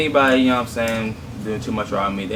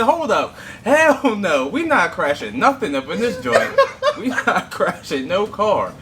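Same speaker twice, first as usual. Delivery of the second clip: laughing; 9 semitones higher, slower than usual. Real, not acted. Refusing to crash, laughing.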